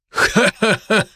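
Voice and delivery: a high scornful cackle; cackling hell torturer. Acted. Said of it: "расхохотался второй бог".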